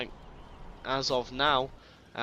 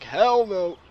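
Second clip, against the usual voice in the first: loud, raised mock alarm; alarmed trooper; 6 semitones higher, 10 dB louder than usual. Caster voices an RPG trooper refusing to stay, in loud mock alarm. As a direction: blurt it out as a fleeing soldier. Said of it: "the R P G trooper's like".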